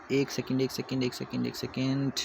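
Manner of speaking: half to herself, soft and slowing; muttered aside. Real, not acted. Teacher mid-lesson fixing her slide, a soft muttered aside. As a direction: murmur it while busy with something else.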